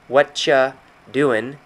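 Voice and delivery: "What ya doing" slowly as "whatcha... doin'", in two parts; slow reduced form. Shown as a demonstration. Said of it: "it's a short phrase for".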